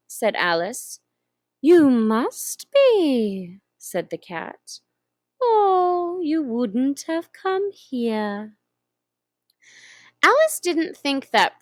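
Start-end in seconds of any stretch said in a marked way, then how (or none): 1.6-3.6 slow, purring Cheshire Cat voice; slow drawling cat. Acted. Grinning cat teasing the lost girl, slow and drawn out. 5.4-8.6 slow, purring Cheshire Cat voice; slow drawling cat. Acted. Grinning cat teasing the lost girl, slow and drawn out.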